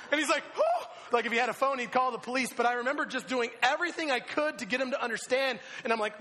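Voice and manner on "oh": very high, loud "oh!"; hammed-up gasp of alarm; startled yelp. Rider startled by the driver's screaming, yelping.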